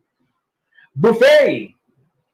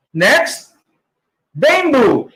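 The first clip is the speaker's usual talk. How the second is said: ordinary talk, nothing marked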